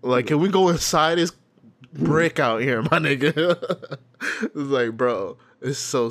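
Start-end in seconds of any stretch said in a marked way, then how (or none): 0.3-4.0 higher, louder mock complaint; complaining cold girl. Girl in a parka wants inside, mock complaining. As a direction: lightly act out a freezing dancer's plea.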